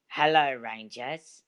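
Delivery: a hint of Australian twang, drawn out; Australian accent. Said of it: "I cannot do a normal voice".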